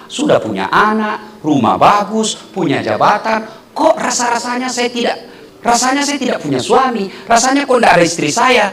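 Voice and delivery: ordinary talk, nothing marked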